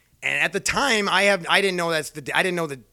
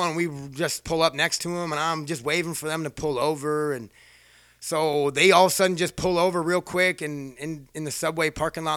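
usual speaking voice

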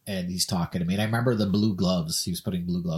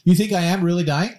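shrill-high and louder, shocked; alarmed disbelief; 9 semitones higher, 5 dB louder than usual. Retold or imitated. Man hearing friend agree he may be dying, alarmed. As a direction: react as if told you might die.

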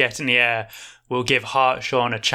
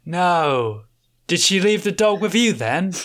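a lightly voiced Aussie bartender, weary and firm; 4 semitones higher than usual. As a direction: cut the drunk off kindly but flatly.

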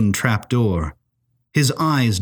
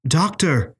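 a startled, higher splutter of surprise; 6 semitones higher than usual. A narrator's reading. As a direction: blurt the name out, caught off guard. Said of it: "he spluttered".

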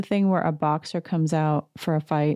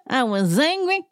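much higher dramatic mock Erica; 10 semitones higher than usual. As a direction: ham up the tortured diva. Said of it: "And she's like".

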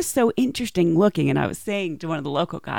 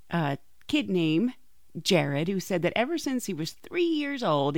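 ordinary delivery

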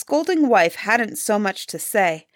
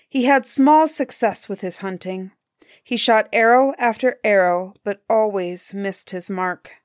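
plain reading voice

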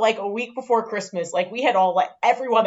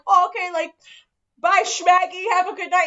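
high, sugary mock farewell; mock sweet goodbye; 8 semitones higher, 3 dB louder than usual. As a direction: wave her off sweetly, pitched up. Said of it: "we're like".

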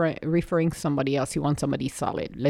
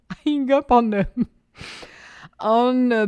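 repeating it higher, through a laugh; 6 semitones higher than usual. Retold or imitated. Amused at having hung up on the boss, laughing. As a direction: laugh at your own blunder as you say it.

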